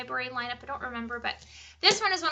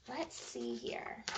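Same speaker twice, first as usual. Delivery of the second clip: whisper; 2 semitones lower, 16 dB quieter, much slower than usual. Real, not acted. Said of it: "whispered aside".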